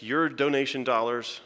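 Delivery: ordinary delivery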